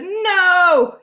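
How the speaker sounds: loud, high, drawn-out no; emphatic arguing shout; stubborn refusal. A narrator's reading. Father quarrels with cashier.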